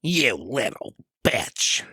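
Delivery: slow, drawn-out mocking imitation; hammed-up slow insult; Sable. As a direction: stretch each word in a put-on voice. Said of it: "I can't do a good Sable".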